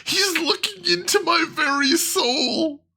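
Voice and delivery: very high, slow wail; amused horror. Real, not acted. Streamer feeling stared down by a game character, amused horror. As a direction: squeal it out slowly, close to laughter.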